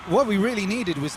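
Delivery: high, loud and exuberant; loud excited thanks. Real, not acted. Streamer thanking the metalwork artist for the gift, exuberant. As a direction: shout out the gift with joy.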